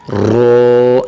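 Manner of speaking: رو said thick with rounded lips, not a thin را; full rounded رو; heavy ro. Shown as a demonstration. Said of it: "یہ رو کو بھی موٹا کر کے پڑھیں گے".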